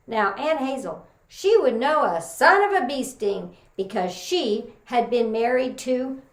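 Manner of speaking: normal talking voice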